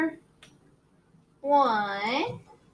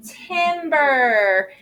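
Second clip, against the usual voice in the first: drawn-out word; much slower than usual. Shown as a demonstration. Teacher models September slowly for the class. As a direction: say September slowly, stretching each part. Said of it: "Can you guys say that word?".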